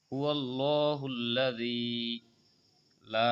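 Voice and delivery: slow, drawn-out Quran recitation. Shown as a demonstration. Teacher demonstrating vowel length to students, slow chant. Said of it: "তিন আলিফ টানতে হবে".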